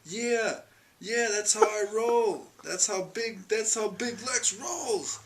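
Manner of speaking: high, drawn-out boastful crowing; gloating. Retold or imitated. Winner shows off his little pinky ring, gloating. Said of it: "He's like".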